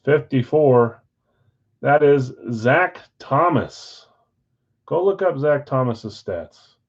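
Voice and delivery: normal talking voice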